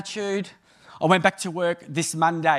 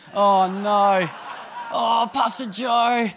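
dread; 2 semitones higher, 3 dB louder, much slower than usual. Retold or imitated. Dread at missed call.

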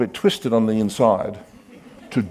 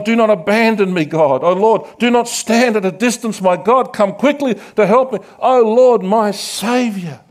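raised, pleading prayer to God; desperate psalm plea, higher and louder; pleading cry; 6 semitones higher, 4 dB louder than usual. Acted. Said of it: "And he goes on".

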